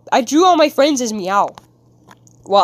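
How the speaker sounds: ordinary talk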